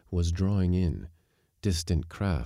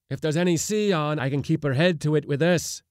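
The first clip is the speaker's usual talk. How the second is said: high, raised defiant call; shouting; 10 semitones higher, 4 dB louder than usual. A narrator's reading. Skipper brandishing a board at the mate, shouted. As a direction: call back defiantly from a distance. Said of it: "the skipper shouted".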